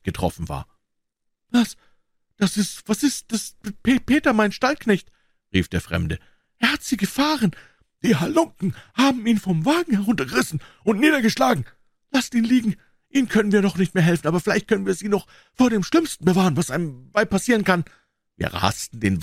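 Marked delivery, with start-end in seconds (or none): 1.5-5.1 high, stammering horror; shocked stranger. Acted. Stranger finds his stable boy wounded, high stammering shock. 6.6-17.8 high, fast and pressing; urgent stranger. Acted. Stranger urges Holmes on to save the woman, high and hurried.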